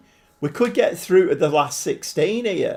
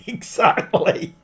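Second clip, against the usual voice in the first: breathy laughing "exactly"; 7 semitones higher, slower than usual. Real, not acted. Streamer agreeing with Alex while laughing.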